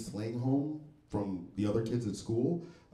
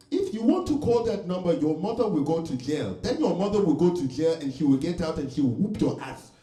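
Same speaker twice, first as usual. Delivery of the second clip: loud, higher-pitched mock threat from mom; flamboyant Nigerian mom; 6 semitones higher, 6 dB louder than usual. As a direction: imitate a mother daring her kids, big and theatrical. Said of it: "she was just really flamboyant".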